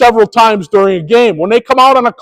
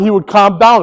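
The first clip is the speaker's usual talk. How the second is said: normal talking voice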